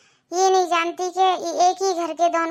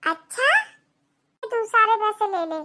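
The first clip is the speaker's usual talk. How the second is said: doting grandmother; 4 semitones higher than usual. Acted.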